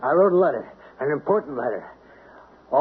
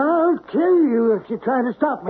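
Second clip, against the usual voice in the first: threatening; 9 semitones higher than usual. Acted. Threatening the mailman.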